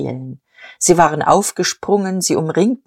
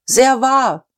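crowd approval; 7 semitones higher than usual. Acted. Crowd cheers the speech.

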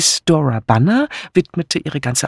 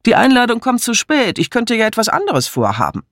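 hoch und nachdrücklich protestierend; abwehrend; 10 semitones higher, faster than usual. Acted. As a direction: wehre dich gekränkt gegen die Einladung. Colonel wehrt die Einladung ab. (empört abwiegelnd)

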